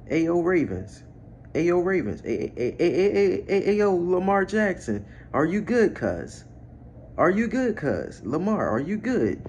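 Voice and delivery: high, stuttering hail; incredulous calling out. Real, not acted. Fan checking on Lamar Jackson after the loss, incredulous calling out. Hails team and quarterback in disbelief.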